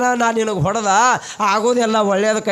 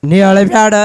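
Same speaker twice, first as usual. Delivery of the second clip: ನೀ ಅಳಬ್ಯାಡ said louder, stress on ಅಳಬ್ಯಾಡ; insistent loud don't-cry plea; louder repeat; 8 dB louder than usual. Acted. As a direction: push the plea harder the second time. Repeated comfort to weeping mother.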